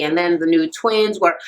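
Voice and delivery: usual speaking voice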